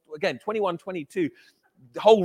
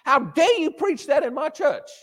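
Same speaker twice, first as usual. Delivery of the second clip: retold outrage, slow and higher; indignant rebuke, retold; 8 semitones higher, slower than usual. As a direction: quote the angry pastor, drawing out each word. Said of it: "exactly what the leader of the church said to me".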